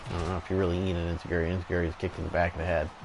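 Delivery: usual speaking voice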